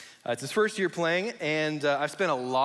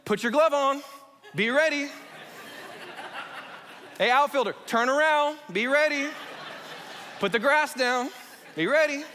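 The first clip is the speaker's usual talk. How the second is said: put-on parent yelling at kids; 9 semitones higher than usual. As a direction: holler it from the bleachers. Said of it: "So usually it's like".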